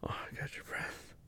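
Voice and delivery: breathy whisper; barely audible whisper. Real, not acted. Intimate moment, unvoiced.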